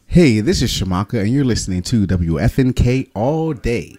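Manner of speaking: lively station ID with a bright "Hey"; upbeat. Shown as a demonstration. Artist records a drop for the station, upbeat. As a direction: give the radio drop some lift.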